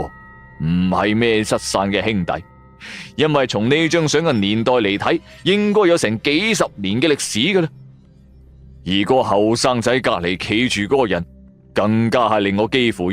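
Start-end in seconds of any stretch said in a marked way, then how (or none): none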